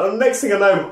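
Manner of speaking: ordinary talk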